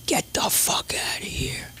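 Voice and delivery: a hushed, breathy aside; stunned whisper to himself; whispered disbelief. Retold or imitated. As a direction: whisper it like you can't believe the offer. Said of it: "And I'm like".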